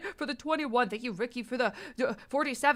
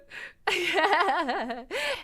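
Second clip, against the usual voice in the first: nervous giggle; 3 semitones higher than usual. Real, not acted. Nervous laugh.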